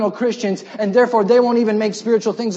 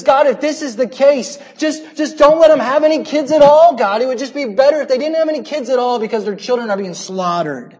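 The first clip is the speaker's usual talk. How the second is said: raised, pleading cry to God; higher and louder, begging God; crying out in prayer; 5 semitones higher, 5 dB louder than usual. Retold or imitated. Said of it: "Hosea cries out".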